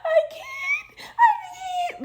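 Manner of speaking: cry-laugh and shout helplessly; high, loud sobbing laughter. Real, not acted. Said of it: "Why are you crying?".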